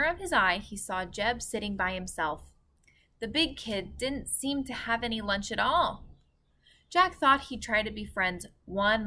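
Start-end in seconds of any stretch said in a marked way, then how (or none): none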